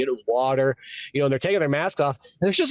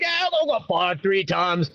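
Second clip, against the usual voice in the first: high, casual Australian voice; pitched-up Aussie impression; Aussie worker; 9 semitones higher than usual. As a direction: play an Aussie bloke telling his story. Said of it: "because in Australia".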